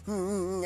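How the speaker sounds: low, soft closed-mouth hums; sullen mock humming. Pastor's wife refusing to say amen, sullen soft humming. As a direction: imitate a sulky "hum, hum".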